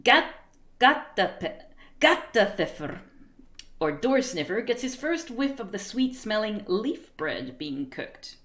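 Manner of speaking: ordinary talk, nothing marked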